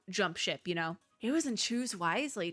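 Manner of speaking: usual speaking voice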